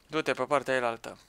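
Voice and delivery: whispered tip. Real, not acted. Whispering a tip to a teammate mid-game, hushed whisper. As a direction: breathe it so the enemy won't hear.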